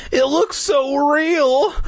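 put-on high, drawn-out awestruck boy; exclaiming. A narrator's reading. Boy staring up at monster, exclaiming. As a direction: stretch it out in high-pitched wonder. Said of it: "Clay exclaimed".

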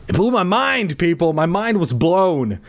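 high, exclaimed, slowing at the end; mock amazement. Host repeats his tripping punchline to listeners, high amazed. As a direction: proclaim it high, stretching the last words.

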